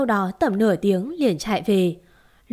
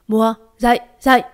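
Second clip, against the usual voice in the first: urgent wake-up call; 6 dB louder, faster than usual. Acted. Aunt shaking her awake.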